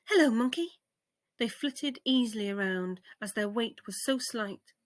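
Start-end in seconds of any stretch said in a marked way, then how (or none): 0.1-0.8 butterfly voices. Acted. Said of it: "they called down".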